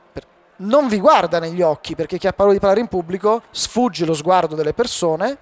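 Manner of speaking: ordinary talk, nothing marked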